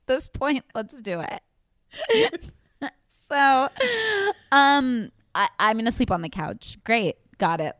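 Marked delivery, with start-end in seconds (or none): 0.7-5.1 slow, wheezing through laughter; crying with laughter; laughing. Real, not acted. Can't stop laughing.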